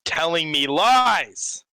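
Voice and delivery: loud, slow accusation; accusing. Acted. Adventurer confronts the man he saw die, loud and slow. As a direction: drag out the accusation and hit it hard.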